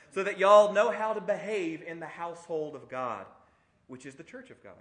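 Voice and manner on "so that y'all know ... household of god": put-on loud, playful Southern accent; Paul with Southern accent. Preacher jokingly voicing an apostle writing to his church, Southern accent. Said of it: "in a southern accent he said that".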